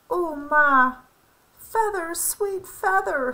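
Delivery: mild sobbing. A narrator's reading. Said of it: "sobbed Lolly".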